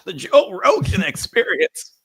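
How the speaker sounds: very high, strained voice with a laugh; RFK impression. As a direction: squeeze it out high and loud. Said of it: "Almost like R F K".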